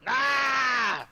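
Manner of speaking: loud, high drawn-out battle yell; battle cry. Acted. Player acting out the spear attack, shouted battle cry. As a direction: let out a charging warrior's scream. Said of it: "and I go".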